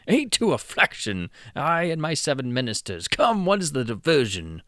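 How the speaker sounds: loud, high royal eagerness; a tipsy king hungry for fun; eager king. Acted. The king presses Hopfrog for the game, loud and high.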